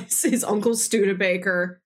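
normal talking voice

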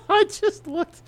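high, loud laughing disbelief; laughing hard. Real, not acted. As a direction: crack up while saying it. Shocked at own playtime.

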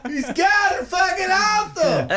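put-on high, yelling drunk fan. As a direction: whoop it up like a rowdy fan. Rowdy redneck fan cheering.

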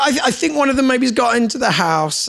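put-on be the anxious kid who heard a noise; child fretting to Dad about a fox in the house; worried child. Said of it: "the kids would be like".

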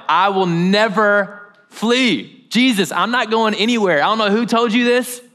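imitated indignant protest, pitched high; emphatic vow. Peter vows loyalty to Jesus.

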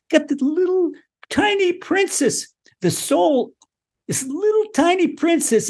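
high, small princess-like voice; tiny and helpless. Retold or imitated. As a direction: make the soul sound tiny.

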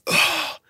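unvoiced effortful ugh of relief; breathless strained grunt. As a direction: push the air out as the grip comes off. Peeling child's hand off throat.

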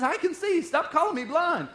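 indignant Bartimaeus. Acted. Bartimaeus voiced, excited protest.

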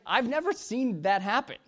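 high-pitched retold disbelief; amazed. Preacher reacting to an unexpected healing, amazed. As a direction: act out amazement, pitched well up.

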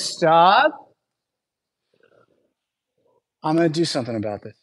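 ordinary talk

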